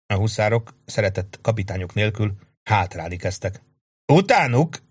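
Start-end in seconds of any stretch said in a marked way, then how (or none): 4.1-4.8 high, loud charge command; officer yelling after fleeing enemy; battle yell. A narrator's reading. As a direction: drive the men forward. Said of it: "ricsorgott Sharp".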